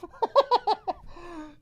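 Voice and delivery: helpless squealing laughter; losing it completely at the chat; high wheezing laugh. Real, not acted. Cracking up at chat.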